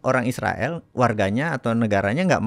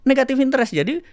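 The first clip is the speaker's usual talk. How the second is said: high, loud excited telling; 12 semitones higher, 4 dB louder than usual. Real, not acted. Explaining the no-interest bank to a friend, high and excited. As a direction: tell it in disbelief.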